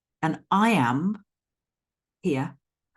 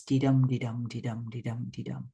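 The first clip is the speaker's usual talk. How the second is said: even "dee dum" beats, stress on every "dum"; iambic beat; 2 semitones lower than usual. Shown as a demonstration. Teacher demonstrating iambs, "dee dum" in steady rhythm. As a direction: chant "dee dum" in a steady pulse, fading away. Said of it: "your stress is on the second syllable".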